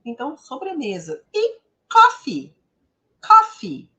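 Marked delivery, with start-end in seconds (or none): 1.3-3.9 high coffee. Shown as a demonstration. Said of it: "uma exceção aqui".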